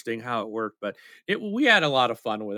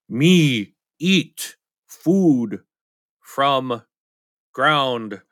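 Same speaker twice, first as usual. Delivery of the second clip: very slow, louder caveman grunts; Neanderthal talk; 4 dB louder, much slower than usual. Shown as a demonstration. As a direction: chop each word like a caveman. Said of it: "you kinda sound like a Neanderthal".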